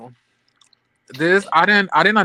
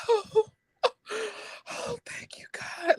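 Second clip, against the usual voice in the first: high, breathy sobbing thanks, hammed up; mock crying relief; 7 dB quieter than usual. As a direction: imitate her shaky, tearful gratitude, very high. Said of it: "Letitia starts shaking and crying like".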